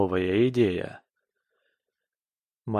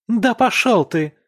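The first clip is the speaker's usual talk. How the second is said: very high, loud yell; wife yelling at husband as she storms out; shouted; 11 semitones higher, 9 dB louder than usual. Acted. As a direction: scream it at him. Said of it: "выкрикивает Ника".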